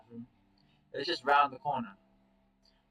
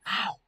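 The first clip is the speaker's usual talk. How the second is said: pained yelp; 9 semitones higher, 3 dB louder than usual. Acted. Chase in pain, neck bite.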